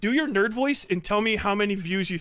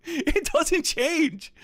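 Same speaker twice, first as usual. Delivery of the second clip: slow, higher mocking nerd voice; 7 semitones higher, much slower than usual. Shown as a demonstration. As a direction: draw it out like a nerd. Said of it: "Your nerd voice is just your voice".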